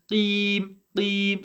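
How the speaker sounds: "Qim" with a long, narrow ee; "Qim" as a drawn "qeem", lips spread; smiling long vowel. Shown as a demonstration. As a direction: smile through the held ee of "Qim".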